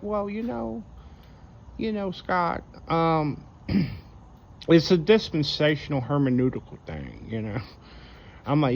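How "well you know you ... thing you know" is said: much higher, hesitant and condescending explaining; imitating a pre-tribber lecturing Scott, pitched way up; mocking pre-tribber voice. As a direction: talk down to Scott with fake scholarly hedging. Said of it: "And they're like".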